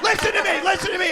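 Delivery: insistent. Real, not acted. Host taking back control.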